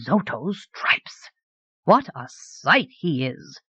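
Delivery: slow, drawn-out amazement; amazed. A narrator's reading. Onlooker seeing the sorcerer come in, slowly amazed. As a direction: stretch each word in astonishment.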